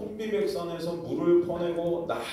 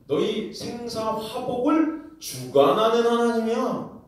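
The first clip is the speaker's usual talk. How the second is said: proclaiming; 6 semitones higher than usual. Acted. Jesus declares himself.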